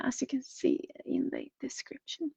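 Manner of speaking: quick whisper; whispering a side note to viewers; whispered aside. Real, not acted. Trailing aside on plans.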